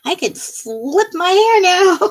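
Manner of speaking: a mock smug brag, laughing. Gloating after being right.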